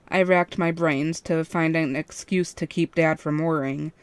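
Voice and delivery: ordinary delivery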